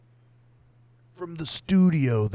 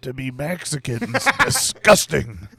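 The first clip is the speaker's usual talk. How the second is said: angry father voice; 7 semitones higher, 9 dB louder than usual. Pretend. Voicing an outraged frog father.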